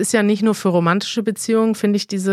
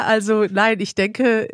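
normal talking voice

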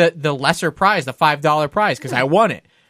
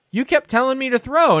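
higher-pitched, incredulous objection; retold indignant comeback; indignant protest; 9 semitones higher than usual. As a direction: protest as if you've been cheated. Arguing back at the carny.

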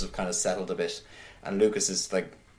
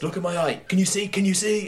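high, louder, insistent mock outburst; acted confrontation; 11 semitones higher, 4 dB louder than usual. Accused man confronting his best friend in church, acted desperate outburst. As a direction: confront him with urgent high-pitched demands. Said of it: "he just confronts him and he goes".

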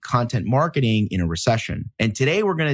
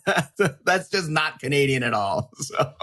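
talking through a light laugh; amused laughing; 2 semitones higher than usual. Real, not acted. Host joking with guest about Canadian accents, light real laughter. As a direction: chuckle as you speak.

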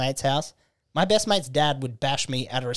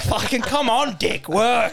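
high, frustrated mock plea; mock exasperated pleading; 8 semitones higher, slower than usual. Urging his body to cooperate, comic exasperation. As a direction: strain upward as if urging it on.

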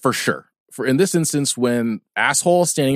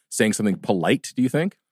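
ordinary delivery

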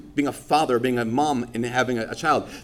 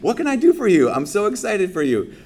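much higher, louder eager innocence; playing dumb; 7 semitones higher, 4 dB louder than usual. Priest playing dumb to the couple, high and loud. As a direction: overplay the helpful innocent priest. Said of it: "play dumb".

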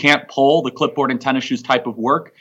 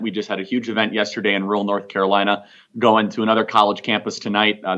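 ordinary talk

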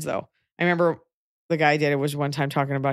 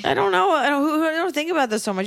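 much higher, put-on voice; high-pitched brush-off; 10 semitones higher than usual. Advising a listener how to dodge her ex-friend, high-pitched brush-off. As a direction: play oblivious in a high voice.

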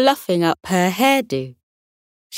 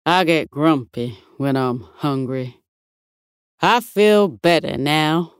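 bulldog voice; 2 semitones lower than usual. Acted.

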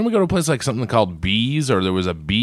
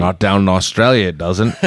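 put-on jokey Australian accent, pitched lower; playful Australian twang; 3 semitones lower than usual. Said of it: "speaking in an Australian accent".

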